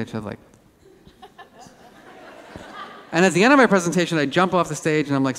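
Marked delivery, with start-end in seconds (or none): none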